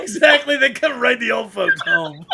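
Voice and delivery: put-on high, laughing old-timer; old man voice. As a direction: play it as a chuckling grandpa. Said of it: "old folks' home".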